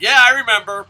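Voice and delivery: loud. Retold or imitated. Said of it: "I'm like".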